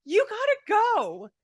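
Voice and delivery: high, slow, stunned order to leave; appalled dismissal. Retold or imitated. Woman ordering the pantless date to leave, high and slow. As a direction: push him out in disbelief.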